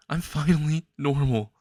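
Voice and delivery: choked up with happy tears; overwhelmed, joyful crying. Acted. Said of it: "tears of joy".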